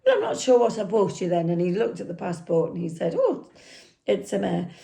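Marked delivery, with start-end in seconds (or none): 0.0-1.5 higher, quicker mock Irish accent. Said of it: "I can't do an Irish accent, so excuse me". 3.2-4.7 put-on Irish accent. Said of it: "I can't do an Irish accent, so excuse me".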